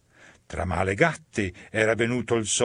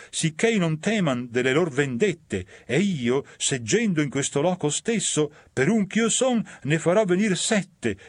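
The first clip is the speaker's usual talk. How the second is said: higher, wheedling offer; scheming sinner; 5 semitones higher than usual. Acted. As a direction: pitch it up to sell the trick.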